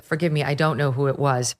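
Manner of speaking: ordinary talk, nothing marked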